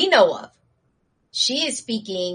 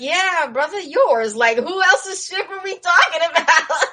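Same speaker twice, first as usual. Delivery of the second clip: laughing exasperation; 9 semitones higher, 5 dB louder than usual. Acted. Everyone answers him with exasperation.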